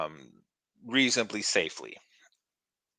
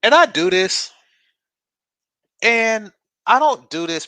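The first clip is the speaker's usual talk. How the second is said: emphatic rant; 12 semitones higher, 10 dB louder than usual. Real, not acted.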